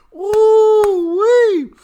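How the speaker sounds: loud high woo-wee hollered; excited whoop. Real, not acted. Host whooping at the plea-deal clip, loud and high. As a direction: whoop it out in delight.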